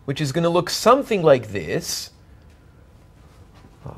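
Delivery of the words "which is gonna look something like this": something leaned on with a rise; tentative emphasis on something, it's only a sketch; stress on something. Shown as a demonstration. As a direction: lean on the word something. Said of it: "let me emphasize the word something".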